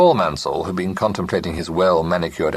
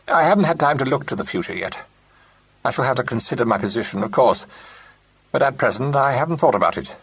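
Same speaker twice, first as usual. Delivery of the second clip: fast, higher, anxious rush; quick and worried; 7 semitones higher, faster than usual. A narrator's reading. Clement deflects the partners, quick worried voice. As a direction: hurry it, fretting. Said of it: "in his quick, worried way".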